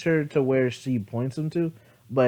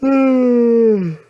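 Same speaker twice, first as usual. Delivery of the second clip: loud sigh; 10 semitones higher, 9 dB louder than usual. Real, not acted. Annoyed at phone.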